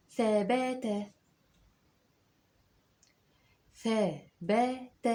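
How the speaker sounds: "ثبات" very slow, syllables drawn out; teacher dictating slowly; slow dictation. Shown as a demonstration. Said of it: "اكتب معايا".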